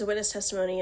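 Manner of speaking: ordinary talk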